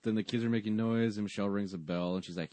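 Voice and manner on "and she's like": ordinary delivery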